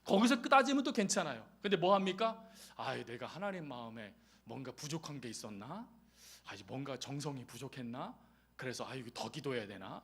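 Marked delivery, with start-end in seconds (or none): 2.8-10.0 soft, lower anxious musing; worried self-questioning. Retold or imitated. Believer imitated fretting over pleasing God, soft and low. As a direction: wonder anxiously to yourself. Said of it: "하고 거룩한 행위를 찾아간다".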